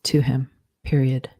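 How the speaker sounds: slow dictation, punctuation spoken aloud; dictating. Shown as a demonstration. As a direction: dictate it word by word with pauses.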